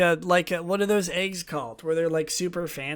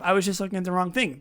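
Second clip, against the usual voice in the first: ordinary talk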